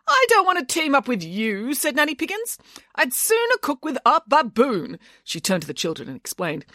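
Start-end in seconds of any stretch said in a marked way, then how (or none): 0.1-5.0 indignant refusal. A narrator's reading. Nanny refuses her rival.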